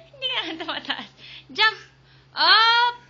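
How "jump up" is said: very loud, high cheer; cheering shout. Real, not acted. Mom cheering her toddler's jump, loud high cheer. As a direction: shout him upward.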